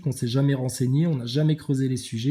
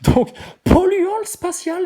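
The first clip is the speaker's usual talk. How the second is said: much higher, louder and slow, ridiculing the comment; mocking read; 7 dB louder than usual. Streamer mocking Ziad's comment, high and drawn out. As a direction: read it out as if it's absurd. Mock-reading a viewer's comment.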